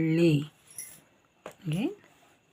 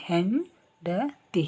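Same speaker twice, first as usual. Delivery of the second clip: slow demo; slower than usual. Shown as a demonstration. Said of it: "this is called ಸೊನ್ನೆ".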